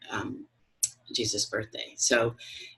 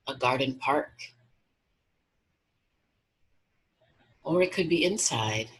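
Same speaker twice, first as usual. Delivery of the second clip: guided meditation. Acted. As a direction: guide them gently into relaxation, unhurried.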